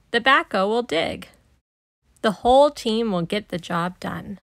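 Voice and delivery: usual speaking voice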